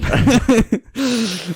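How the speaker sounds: high laugh. Real, not acted. Laughs at own joke.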